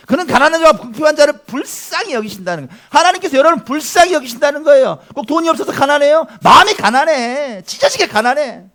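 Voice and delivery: loud, high, forceful sermon voice; impassioned preaching. Real, not acted. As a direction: press it home to the congregation. Preacher drives the verse home.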